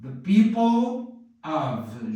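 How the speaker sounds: the people of said slowly, L joined onto of; slow linking demo. Shown as a demonstration. Teacher slowly shows the L linking in people of. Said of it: "Você vai ter que falar assim".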